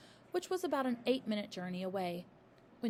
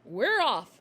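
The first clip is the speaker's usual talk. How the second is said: loud curt announcement; the father brushing her off; gruff father; 2 semitones higher, 10 dB louder than usual. A narrator's reading. Father voiced in read story.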